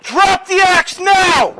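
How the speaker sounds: slow, high, full-force police shout; shouting commands. Real, not acted. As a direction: hammer each word out at full volume. Police officer confronting armed man.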